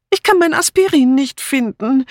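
very high, pitiful wail; plaintive. A narrator's reading. Dora back downstairs without her aspirin, plaintive. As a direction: complain helplessly in a high voice. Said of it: "erklärte Dora kläglich".

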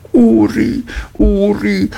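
a worried mother crying out her son's name. A narrator's reading. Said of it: "rief die Mutter".

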